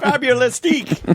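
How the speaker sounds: high-pitched mock German accent; imitating a German. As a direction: mimic the German host's sign-off. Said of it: "He's like".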